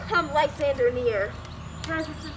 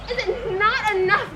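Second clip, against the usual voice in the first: louder, stress on not; indignant repeat; 3 semitones higher, 9 dB louder than usual. Acted. Helena repeats it to Lysander, louder and indignant. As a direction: throw it back at him hurt and angry.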